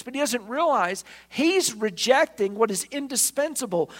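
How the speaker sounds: ordinary talk, nothing marked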